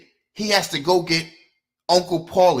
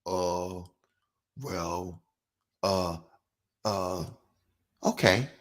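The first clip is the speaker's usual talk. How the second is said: much lower and softer, hesitant hems; mock sheepish hemming; 9 semitones lower, 7 dB quieter, much slower than usual. Mocking trolls who freeze when challenged, sheepish stammer. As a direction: imitate a caught-out commenter stammering. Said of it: "You know what they say?".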